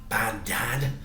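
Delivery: usual speaking voice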